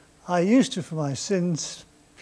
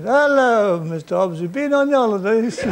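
ticket collector; 4 semitones higher, 7 dB louder than usual. Retold or imitated. Ticket collector greets Hobbs.

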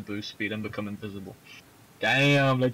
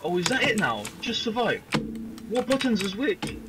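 scared horror game streamer; 9 semitones higher, 3 dB louder than usual. Real, not acted.

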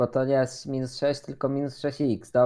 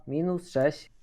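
ordinary talk, nothing marked

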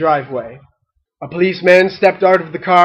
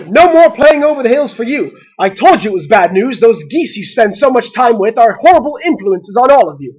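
loud, angry motherly rant; yelled scolding; 5 semitones higher, 5 dB louder than usual. A narrator's reading. Mother banning play with the geese, yelling. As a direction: lay down the law at full voice. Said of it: "yelled their mother".

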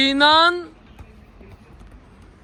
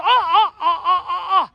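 laughing; 3 semitones higher, 4 dB louder than usual. Real, not acted. Laughing at a wild scene.

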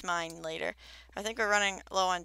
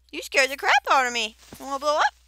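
startled; 7 semitones higher, 10 dB louder, faster than usual. Real, not acted. Startled by a creeper.

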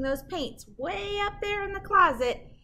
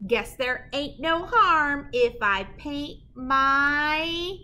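slow, drawn-out build to the rhyme; suspense pause; 3 semitones higher, 5 dB louder, much slower than usual. Acted. Reading to kids and pausing before the rhyme, drawn-out suspense. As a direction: leave them hanging before the rhyme.

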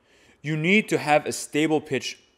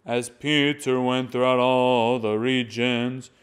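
slow chanted reading, Peter lifted; raised stressed syllable; 2 semitones lower, slower than usual. Shown as a demonstration. Chanter teaching students to lift key syllables, slow. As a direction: intone the line, Peter going up. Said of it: "when you go up a little bit higher".

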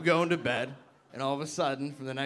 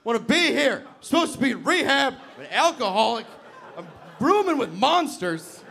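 high, loud ranting; hammed-up desperate yelling; hysterical outburst; 9 semitones higher, 6 dB louder than usual. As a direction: shout it like a full tantrum, building to a scream. Acted outburst demanding to leave.

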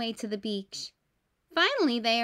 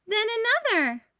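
more excitement; 10 semitones higher, 4 dB louder than usual. A narrator's reading. Counting red train cars.

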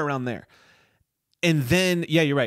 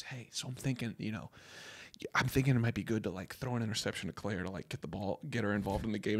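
low hush, leaning in to share a plan; hushed scheming; 3 semitones lower, 11 dB quieter, faster than usual. Retold or imitated. Owen Wilson pitching a sneaky plan to his teammate, hushed. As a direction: murmur it like a secret plan to a teammate. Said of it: "he goes".

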